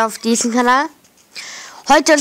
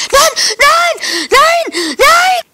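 despairing shout; 6 dB louder, much slower than usual. Real, not acted. Gets eaten in game, despair.

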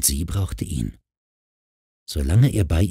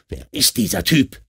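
high-pitched angry bark, without full volume; barking demand; 11 semitones higher than usual. A narrator's reading. Husband hears a strange man on the line, barking angrily. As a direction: bark it into the phone. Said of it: "bellte Mark in den Hörer".

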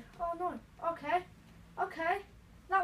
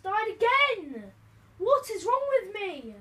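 loud, slow and higher cry at himself; loud exasperated cry; 6 semitones higher, 11 dB louder, slower than usual. Real, not acted. Gamer dies again and cries out loudly at himself. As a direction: howl it out in frustration.

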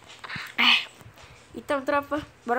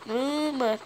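usual speaking voice

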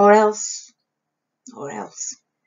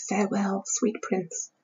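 very soft, reverent murmur; tender quotation, much quieter; hushed farewell; 7 dB quieter than usual. A narrator's reading. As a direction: murmur it like a private goodbye. Isabel recalls Hamlet's death dreamily.

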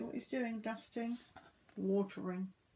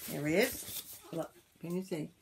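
ordinary delivery

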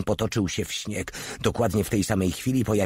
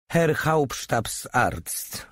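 raised, slow, drawn-out salutation; formal military address; 6 semitones higher, much slower than usual. A narrator's reading. Porta at attention addressing the head doctor, formally. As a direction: report to a superior standing at attention. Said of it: "oświadczył, stając na baczność Porta".

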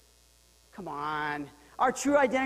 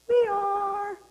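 high, loud rallying answer; called answer; 11 semitones higher, 6 dB louder than usual. Shown as a demonstration. Leading the congregation's response, loud and high. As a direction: shout it out for the crowd. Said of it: "Can you say it with me?".

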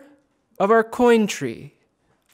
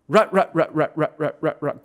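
sound play; 2 semitones lower than usual. Acted. Playing with sounds.

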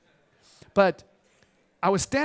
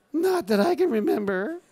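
higher, mock-nervous voice with a laugh; retold nervous fib; 6 semitones higher than usual. Photographer asked if they have met, nervously evasive. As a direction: play the jittery denial for laughs. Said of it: "I start shaking".

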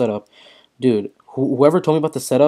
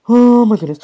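excited yell; 10 semitones higher, 8 dB louder than usual. Real, not acted. Gamer reacting to a big crit.

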